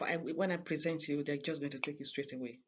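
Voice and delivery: normal talking voice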